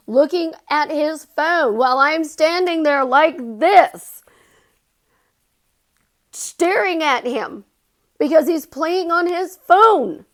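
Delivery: loud, high-pitched indignant retelling; exasperated venting. Retold or imitated. Girlfriend recounts watching him on his phone, exasperated.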